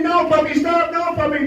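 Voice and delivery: Tommy calling after his puppy, urgent; screaming. Acted. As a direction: yell after the runaway puppy.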